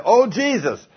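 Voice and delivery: high mock gasp of some guy; profane exclamation. Preacher mimics a man swearing, high mock cry. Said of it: "some guy say".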